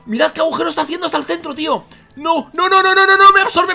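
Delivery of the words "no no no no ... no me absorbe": high, loud string of cries; game panic. Real, not acted. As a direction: yell frantically while being pulled in. Being absorbed again.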